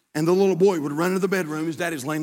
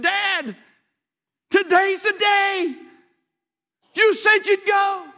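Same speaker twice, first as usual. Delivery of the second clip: put-on little boy insisting; 12 semitones higher, much slower than usual.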